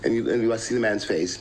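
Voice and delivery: ordinary talk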